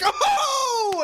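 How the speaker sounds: very high delighted "Oh!"; a laughing shout of surprise; a bartender amazed at the throw; amazed yelp. Acted. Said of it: "And he's like".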